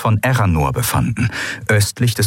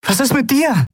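high, anxious question; worried question. A narrator's reading. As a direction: ask out of worry for her. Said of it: "Endriel turns back to Aylan".

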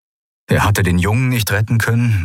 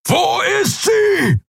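much higher, slow furious roar; slower than usual. Acted. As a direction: bellow the question at them. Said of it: "brüllte er".

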